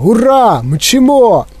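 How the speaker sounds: high, exhilarated cheering; excited cry. A narrator's reading. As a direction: whoop with the thrill of speeding. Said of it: "кричав дідунь Джо".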